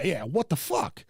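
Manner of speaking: ordinary talk, nothing marked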